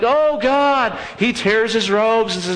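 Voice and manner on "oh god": high wailing cry to God; mock lament. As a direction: wail it like Joshua tearing robes. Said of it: "Joshua's like".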